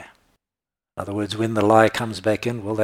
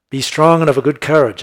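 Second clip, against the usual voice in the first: proclaiming scripture; 5 semitones higher, 8 dB louder than usual. Acted. Preacher proclaiming scripture verse.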